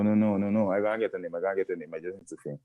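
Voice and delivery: ordinary talk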